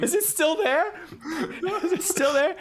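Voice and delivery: high-pitched anxious wail; comic panic. Acted. Checking on a singed mustache, high comic panic. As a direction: ask in mock horror, very high.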